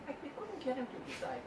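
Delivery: ordinary talk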